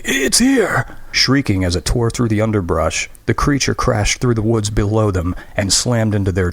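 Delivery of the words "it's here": very high, panicked shout; terrified cry. Acted. Graham crying out as the creature reaches the tree, terrified. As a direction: cry out as the monster arrives. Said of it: "cried hoarsely".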